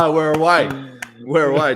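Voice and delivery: loud cheery "we're away"; crowd cheering. Retold or imitated. Wedding guests cheer after the best man's one-liner, loud and relieved. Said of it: "Then they're like".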